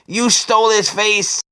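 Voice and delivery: lightly voiced woman, slow and cutting; Margot's accusation. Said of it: "Margot saying".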